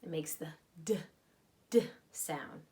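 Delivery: D said as a short voiced d sound; letter sound. Shown as a demonstration. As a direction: whisper the D sound, puh-free. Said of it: "what sound the letter D makes".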